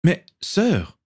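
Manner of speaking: high, mildly flustered protest; a touch of stammering dismay. A narrator's reading. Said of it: "balbutia le comte".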